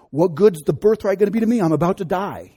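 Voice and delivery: drama queen. Acted. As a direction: complain as if dying of hunger. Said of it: "He was a drama queen".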